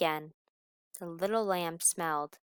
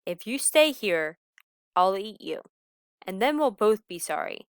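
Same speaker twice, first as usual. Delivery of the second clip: loud warning; 7 dB louder than usual. Acted. Wolf warns lamb.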